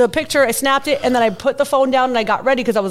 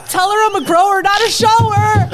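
raised, higher voice, mock indignant; protesting Jeff; 9 semitones higher, 4 dB louder than usual. Jeff defending his naked photo, mock protest. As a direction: imitate a man loudly defending himself. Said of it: "he's like, he's like".

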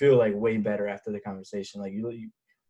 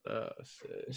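breathy whispered oh shit; 13 dB quieter than usual. Real, not acted. Reacts to missing barber.

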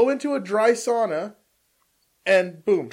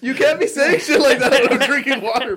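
laughing; 5 semitones higher, 5 dB louder than usual. Real, not acted. Just did a spit take.

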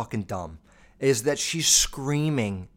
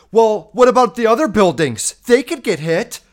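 high, loud, whiny protest; hammed-up shrill indignation; mocking someone's outburst; 10 semitones higher, 10 dB louder than usual. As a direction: shout it high like a clueless objector. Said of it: "is like someone going".

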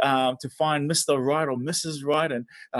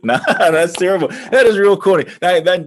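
laughing; 9 dB louder than usual. Real, not acted. Laughing at own pickup line.

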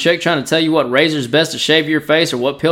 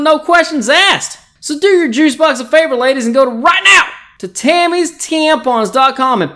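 excited ad pitch; 7 semitones higher than usual. Acted. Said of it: "I have an ad here".

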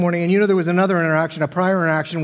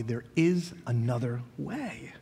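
slow, breathy hush of a gentle invitation; hushed whisper; 7 semitones lower, 7 dB quieter, slower than usual. Acted. Preacher whispering Jesus's promise to the congregation, unvoiced and slow. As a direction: whisper it slowly, like a secret promise.

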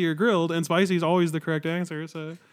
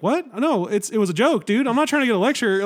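higher-pitched retold protest; indignant protest; 6 semitones higher, 4 dB louder than usual. Patient telling the student dentist it was a joke, indignant. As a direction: push back, mock exasperated.

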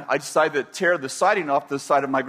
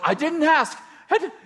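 much higher whiny protest; child defending himself to mom; kid protesting; 11 semitones higher than usual. Retold or imitated. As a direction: plead innocence like a child.

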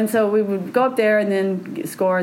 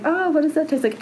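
curious beginner; 7 semitones higher than usual. Acted.

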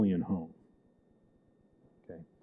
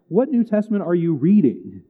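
higher-pitched, exasperated disbelief; incredulous retort; 7 semitones higher, 5 dB louder than usual. Acted. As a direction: challenge them as if it's absurd. Mock retort to deniers of divinity.

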